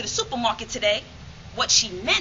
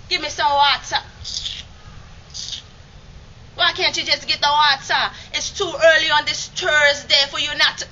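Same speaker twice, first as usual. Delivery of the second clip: louder, impatient West Indian grandmother; West Indian accent; 5 semitones higher, 6 dB louder than usual. Retold or imitated. Grandmother demanding water again, West Indian accent. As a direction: push it, exasperated, in a West Indian lilt. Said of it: "spoke with such a heavy West Indian accent".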